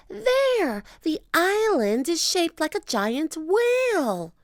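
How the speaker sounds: put-on a kid pointing it out; excited girl. Annie shows Jack, high and excited.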